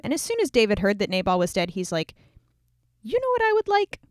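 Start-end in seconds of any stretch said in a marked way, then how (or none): 3.0-4.0 high, coy voice of a sudden idea; high, teasing question; sly delight. Retold or imitated. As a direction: fish for a treat, playfully. David hears Nabal is dead.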